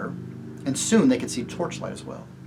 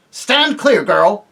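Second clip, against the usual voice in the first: much louder, higher command; commanding shout; 8 semitones higher, 11 dB louder, much slower than usual. Acted. Tirian orders Jill away, loud shout. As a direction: bark it at her.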